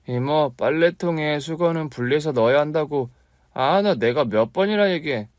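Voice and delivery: put-on nagging kid. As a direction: scold your aunt like a fussy boy. Boy nags his aunt.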